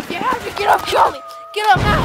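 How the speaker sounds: loud pleading yell; desperate shout. Acted. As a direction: scream at him to get up. Dragging a downed partner.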